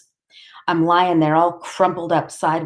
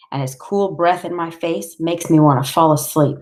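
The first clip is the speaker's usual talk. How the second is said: ordinary delivery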